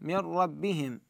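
نون of من merged into the ر of ربهم, slow; tajweed demo. Shown as a demonstration. Teacher showing the class a slow tajweed merge. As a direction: recite من ربهم as مِرَّبِّهِم, slow and careful. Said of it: "فنقول مثلًا".

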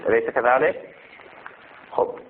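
normal talking voice

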